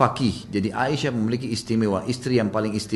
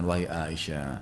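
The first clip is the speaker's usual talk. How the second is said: soft, low address to a wife; retold gentle, well-mannered voice; kind, gentle; 6 semitones lower, 5 dB quieter, slower than usual. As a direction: speak low and tenderly, courteously. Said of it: "dengan suara baik, santun".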